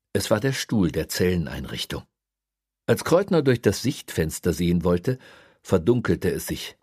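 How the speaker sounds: plain reading voice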